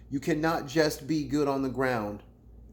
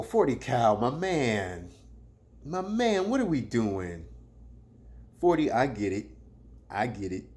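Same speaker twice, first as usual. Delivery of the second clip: [name] impression; 2 semitones lower than usual. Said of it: "That's my forty Cal impression".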